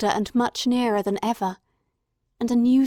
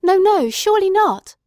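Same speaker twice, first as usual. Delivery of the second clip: much higher and louder, pleading denial; anguished outcry; 10 semitones higher, 8 dB louder than usual. A narrator's reading. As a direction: refuse to believe it, crying out. Watson denies the worst.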